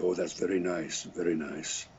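put-on polite, distant pianist in a Polish accent; mock Polish accent. Answering the fiddler lady in a put-on Polish accent. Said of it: "[name]'s Polish accent".

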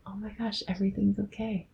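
near-whispered reassurance to oneself; relieved, hushed. Acted. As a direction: say it as a quiet, private sigh of relief. Voices relieved inner thought.

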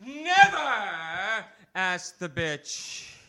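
high, loud wail fading into slow drawn-out never; anguished outcry. Acted. Poet wailing his regret to the crowd. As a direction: cry out the regret, then drag it. Slam poem opening, building outcry.

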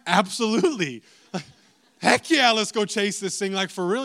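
excited shout of agreement; hyped reply. Retold or imitated. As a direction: jump at the chance, pumped. Excited reply in retold story.

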